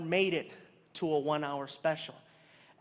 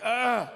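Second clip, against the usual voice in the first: disgusted ugh; 4 semitones higher, 5 dB louder than usual. Retold or imitated. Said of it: "I was like".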